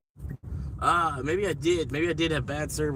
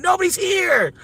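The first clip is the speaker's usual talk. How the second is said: high, loud yelling at the car ahead; shouting at driver; 14 semitones higher, 3 dB louder than usual. Real, not acted. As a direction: yell in disbelief at a driver who can't hear you.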